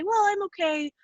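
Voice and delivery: retold polite reply. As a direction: lift the pitch for a mock modest reply. Retold polite answer about coping.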